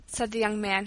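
ordinary delivery

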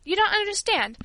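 high-pitched insistent objection; breaking in. A narrator's reading. Old servant interrupts the worried girl, insistently breaking in. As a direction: jump in to correct the listener. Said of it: "Teresa broke in".

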